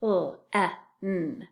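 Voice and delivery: "P-L-E-N" as isolated sounds p, l, short e, n; sounded out. Shown as a demonstration. Teacher tapping the first syllable, "P-L-E-N" as separate sounds. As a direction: tap out "P-L-E-N" sound by sound, not letter names. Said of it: "First syllable".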